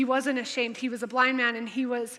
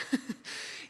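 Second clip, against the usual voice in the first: laughing; 11 dB quieter than usual. Real, not acted.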